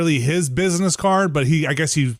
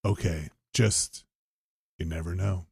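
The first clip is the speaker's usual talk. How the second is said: ominous salesman; 10 semitones lower, 9 dB quieter than usual. Retold or imitated. Imitating salesman.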